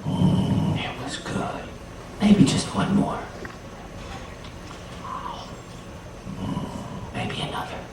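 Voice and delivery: soft sneaky mm of tasting; hushed tasting murmur. A narrator's reading. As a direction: savour it under your breath, sneaking bites. Anansi tasting beans secretly.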